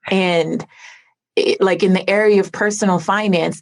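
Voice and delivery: ordinary delivery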